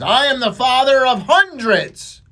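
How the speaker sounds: loud, high, slow indignant proclamation; exasperated priest raising his voice; upset priest. Acted. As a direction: declare it slowly and loudly, losing patience. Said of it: "Priest is getting a little upset".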